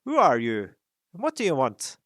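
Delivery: loud startled challenge; shouted. A narrator's reading. Guards leaping up at Thorin, loud shout. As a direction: bark it out at the intruder. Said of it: "they shouted".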